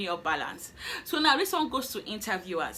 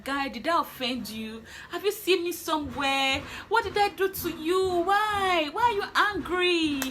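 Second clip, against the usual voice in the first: indignant protest; 7 semitones higher than usual. Retold or imitated. To the rude interviewer in her head, rising indignation. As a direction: build up the outrage at him. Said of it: "I was just like".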